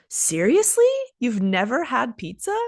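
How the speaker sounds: shocked, high rising Seriously; amazed at a friend; surprised. Shown as a demonstration. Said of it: "seriously can express surprise".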